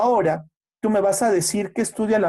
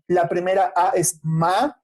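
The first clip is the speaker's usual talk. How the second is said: usual speaking voice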